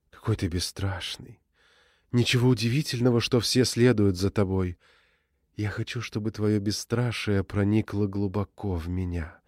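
lightly voiced sweet seductive woman; soft, sugary, teasing purr; sweet voice of Mara. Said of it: "сладким голосом Мары".

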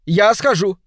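loud, high, eager shout; volunteer with a sudden cry; calling out. A narrator's reading. Suspect jumps in to fetch police, loud cry. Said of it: "крикнул Леверинг".

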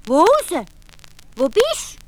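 put-on boy calling out to find the hidden voice; Hans ruft. A narrator's reading. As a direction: call into the forest looking for the voice. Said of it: "Da ruft der Hans".

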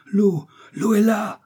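high, drawn-out excited cry; soaring shout of discovery. A narrator's reading. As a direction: cry out slowly and loudly at the discovery. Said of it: "s'écria le docteur".

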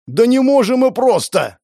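high, loud, slowed outburst; heated shout. A narrator's reading. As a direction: cry it out in frustration. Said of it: "В сердцах крикнул Константин".

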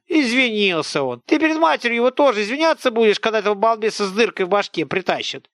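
high, loud village scold; an angry peasant woman tearing into a boy; scolding old woman. Acted. Woman mocking his apology. (сварливая тётка, громко и высоко)

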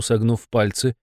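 ordinary delivery

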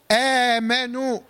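slow chanted آمنوا with a long opening "aa"; madd badal example. Shown as a demonstration. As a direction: recite آمنوا, lengthening the vowel after the hamza. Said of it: "يبقى اسمه مد إيه؟".

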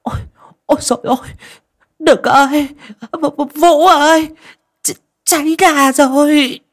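put-on high, loud panicked old woman crying for help. Old woman calling her son as the altar catches fire, panicked high loud cry. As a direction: scream for help as the incense bowl catches fire. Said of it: "Bà cụ kêu lên".

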